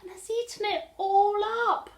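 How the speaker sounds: very high, loud little bear voice; a tiny bear upset about his porridge; Baby Bear. Acted. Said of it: "And Baby Bear said".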